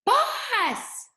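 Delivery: "Boss" yelled up high, falling away; "Boss" called out high and loud; screamed name. Shown as a demonstration. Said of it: "He says".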